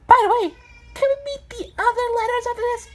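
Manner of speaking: very high, squeaky request; eager number character. Acted. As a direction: ask eagerly in a high cartoon voice. Number asks to meet more letters.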